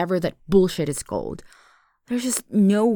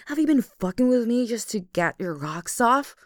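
normal talking voice

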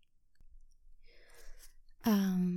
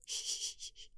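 soothing shush; 15 dB quieter than usual. Acted. Girlfriend hushing her crying boyfriend, soft shushing. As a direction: hush him gently like a crying child.

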